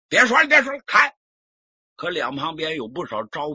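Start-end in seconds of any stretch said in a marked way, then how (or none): 0.1-1.1 loud, high angry cut-off; impatient shout. Acted. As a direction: shout him down. Official cutting off the plea. (不耐烦地喝断)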